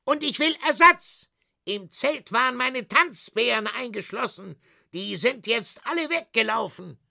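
high, agitated complaint; high-pitched outraged protest; indignant circus director. Acted. Circus director demands compensation.

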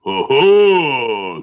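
loud gruff giant roar; booming shout. Acted. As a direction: bellow it like a giant. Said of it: "schrie er".

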